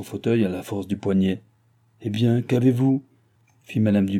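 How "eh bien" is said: usual speaking voice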